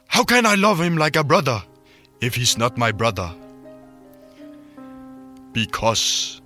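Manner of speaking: put-on Welsh and Irish accents. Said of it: "jeg lavede bare en walisisk og irsk accent".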